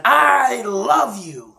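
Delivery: high, loud, drawn-out shout of love; boy shouting love into the cave; loving yell. Acted. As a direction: call it out with all your heart. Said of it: "yell out at the top of your lungs".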